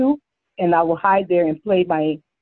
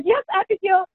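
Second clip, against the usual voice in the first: 1 excited answer; 11 semitones higher than usual. Retold or imitated. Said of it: "I ran up like".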